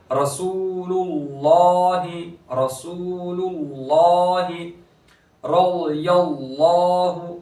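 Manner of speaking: slow chant of रसूलुल्लाहि, first लाम thin and the लाम of Allah heavy; tajweed recitation. Shown as a demonstration. Teacher recites the word for students. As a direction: stretch the वाव and thicken the second lam. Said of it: "रॉ को पूर करेंगे".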